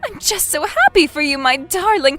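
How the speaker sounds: high and loud, rushing on; beaming, unstoppable delight; overjoyed mother. Acted. Mother assuming a boyfriend, overjoyed and high-pitched. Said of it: "Mom, calm down".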